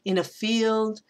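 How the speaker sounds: FIELD held long on a level note; light lead-in, then stretch "field"; anapest. Shown as a demonstration. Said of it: "two unstressed and a stressed".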